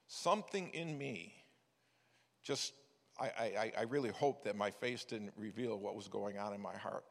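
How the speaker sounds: ordinary talk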